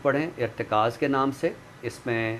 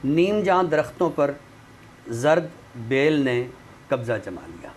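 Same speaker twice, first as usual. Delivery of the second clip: poem recitation; 3 semitones higher, 4 dB louder than usual. Acted. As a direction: recite each image with weight and pauses. Recites a nazm on the show.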